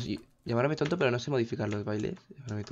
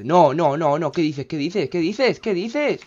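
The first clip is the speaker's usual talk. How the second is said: loud panicked repeats climbing in pitch; alarmed disbelief; 4 semitones higher, 7 dB louder, much slower than usual. Real, not acted. Gamer watching disaster hit the village, panicked disbelief. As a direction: keep repeating it, each one higher, in real alarm. Panics as the game goes wrong.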